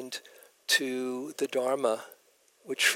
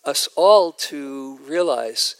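ordinary talk, nothing marked

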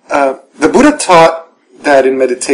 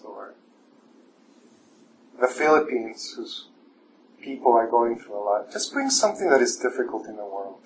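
guided meditation; 7 dB quieter than usual. Acted. Teacher guiding the evening class through standing meditation, soft and calm. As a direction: guide the room gently inward, unhurried.

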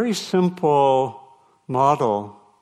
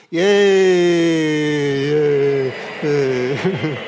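loud, drawn-out yay, tapering off; big celebratory whoop that slowly falls away; playful cheer; 3 semitones higher, 5 dB louder, much slower than usual. Pretend. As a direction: cheer long and loud as a mock-traditional greeting. Mock-traditional group cheer of welcome.

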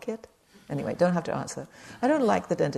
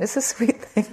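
ordinary talk

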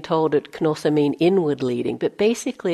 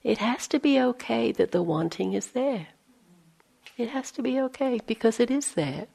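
kind reassurance; 5 semitones higher, 5 dB quieter than usual. Retold or imitated. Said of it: "Joseph looking at me so kindly".

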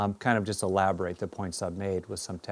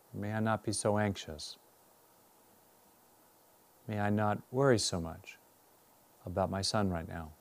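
guided meditation. Real, not acted. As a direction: guide them inward, unhurried and measured.

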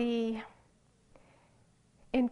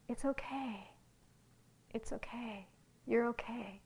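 softer, whispered reassurance; tender inner voice; 8 dB quieter than usual. Retold or imitated. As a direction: comfort gently, like a heartful inner voice. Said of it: "that voice ... sometimes it says".

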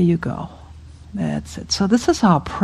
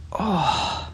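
soft, long sighed "oh"; kvetching groan; 9 dB quieter than usual. Shown as a demonstration. Teacher kvetching along with the class, soft sighing groan. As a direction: sigh out an ache. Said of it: "you have nothing to kvetch about".

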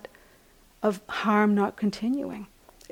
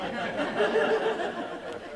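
soft, high laugh; 12 semitones higher, 6 dB quieter than usual. Real, not acted. Amused at own lack of saintliness, soft laughing. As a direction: laugh lightly at yourself.

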